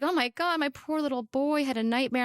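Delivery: high-pitched pitying gasp of a line; retold motherly alarm. Mom reacting to her son's nightmare, alarmed.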